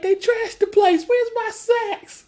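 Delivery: hammed-up shrill panic; freaking out. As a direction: wail it like everything's gone. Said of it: "Steve was freaking out".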